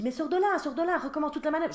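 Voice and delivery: put-on high, loud pushy teen voice; impatient son. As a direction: hurry mum along like an exasperated teen. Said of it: "Mon fils me dit".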